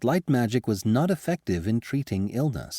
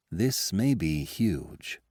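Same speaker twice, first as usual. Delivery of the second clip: grave; much slower than usual. Acted. Advisor's grave realization.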